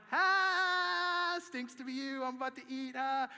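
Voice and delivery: high, loud mocking singsong; gloating tease at the hungry students; taunting. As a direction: rub it in like a bratty kid. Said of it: "making fun of 'em".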